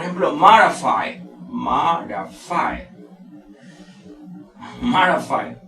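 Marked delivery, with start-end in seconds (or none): 1.5-2.8 marafy drawn out slowly in separate syllables; slow, lower breakdown of marafy. Shown as a demonstration. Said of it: "mayor fuerza de voz está en la primera sílaba". 4.8-5.6 marafy, strongest at the start, falling; front-stressed model of marafy; stress on first syllable. Shown as a demonstration. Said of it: "mayor fuerza de voz está en la primera sílaba".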